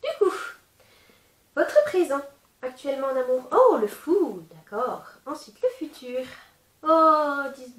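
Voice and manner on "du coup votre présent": ordinary talk, nothing marked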